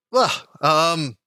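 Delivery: rising burst of welcome, then a puzzled question; flustered smith character. Acted. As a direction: greet unexpected guests, stumbling and eager. Game master voices the sooty smith.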